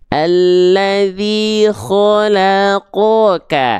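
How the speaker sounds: measured, sustained tajwid recitation; Qur'anic recitation. Shown as a demonstration. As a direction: recite with long vowels and thick kho and qo. Demonstrates Qur'anic recitation with thick letters.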